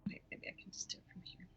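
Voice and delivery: whispered aside to herself; whispering. Real, not acted. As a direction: whisper it under your breath. Muttering to herself.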